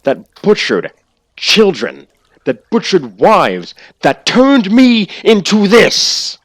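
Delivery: high, loud, raging accusation; furious villain rant. Acted. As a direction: spit it out with fury. Villain rant, angry speech.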